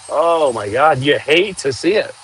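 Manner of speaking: ordinary talk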